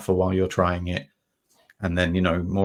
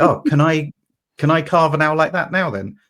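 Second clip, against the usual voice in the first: mock eager; 8 semitones higher, 8 dB louder than usual. Eager novice asks.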